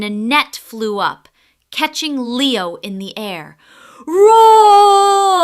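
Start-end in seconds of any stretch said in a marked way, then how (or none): 4.0-5.4 very loud, high roaring cry; lion caught in a net, loud roar; trapped lion roar. Acted. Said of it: "he roared".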